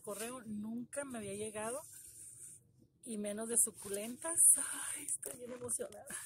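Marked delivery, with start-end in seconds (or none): 4.6-6.1 breathless whisper of real excitement; excited whisper. Real, not acted. Opening a gift box, she whispers her excitement. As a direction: whisper it, overwhelmed with joy.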